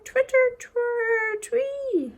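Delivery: high chirping twitter; birdsong. Acted. Birdie Brown singing on the way home, high chirps. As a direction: trill it like a small bird. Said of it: "Birdie Brown sang".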